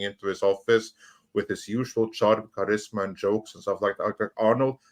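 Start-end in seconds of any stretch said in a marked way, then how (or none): none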